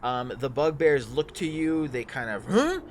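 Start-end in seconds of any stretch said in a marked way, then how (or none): 2.4-2.8 loud, high startled grunt of confusion; a sudden baffled bugbear huh. Acted. Bugbears startled by the stranger, baffled huh.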